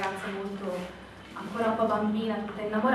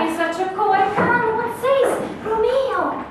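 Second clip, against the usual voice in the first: higher and louder, eager and impatient; impatient cry; 11 semitones higher, 11 dB louder than usual. Acted. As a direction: burst out with eagerness. Juliet's impatient exclamations.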